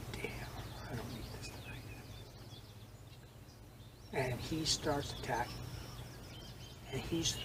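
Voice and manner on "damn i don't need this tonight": very soft muttered grumble to himself; low, dreading aside. Retold or imitated. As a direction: say it under your breath, dreading it. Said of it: "I went".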